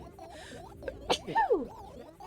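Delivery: a harsh rising cough; coughing fit. Real, not acted. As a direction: cough hard. Cough tag alone.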